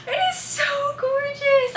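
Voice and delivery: high, breathless delight; awed excitement. Real, not acted. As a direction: gush over the reveal. Reveal of the portrait.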